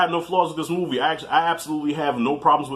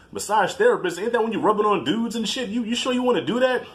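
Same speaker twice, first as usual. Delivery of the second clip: lightly voiced skeptical dad, pitched higher; disapproving father; 7 semitones higher than usual. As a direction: echo it back in doubt, imitating Dad. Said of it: "Pop didn't like it".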